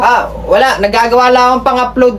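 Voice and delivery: ordinary delivery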